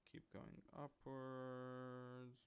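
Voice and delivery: slow, held note while nudging something along; drawn-out sing-song. Real, not acted. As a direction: stretch the last word out very slowly. Sing-song while dragging light upwards.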